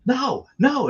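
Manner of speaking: alarmed refusal. Retold or imitated. Seeing her nuts, alarmed.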